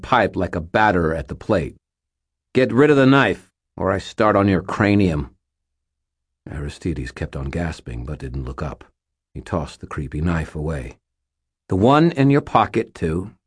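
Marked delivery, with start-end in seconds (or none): 2.5-5.3 threatening. Acted. Threatening with lead pipe. 11.7-13.3 firm command. Acted. Ordering the sorcerer.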